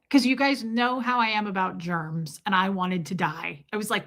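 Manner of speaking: ordinary delivery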